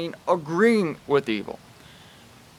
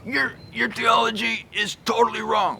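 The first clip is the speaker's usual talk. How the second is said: "Your theology is totally wrong" pitched up, scornful; aggressive rebuke; 6 semitones higher than usual. Shown as a demonstration. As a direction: sneer it, higher than usual.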